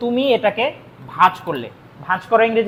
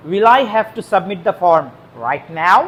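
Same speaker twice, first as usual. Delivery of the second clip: slow dictation; much slower than usual. Shown as a demonstration. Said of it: "slow dictation to students".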